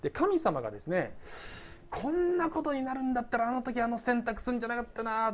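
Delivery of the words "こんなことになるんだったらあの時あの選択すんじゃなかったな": acted human hand-wringing; mock fretful regret. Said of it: "人間みたいにくよくよする".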